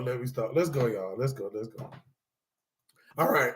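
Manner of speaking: ordinary talk, nothing marked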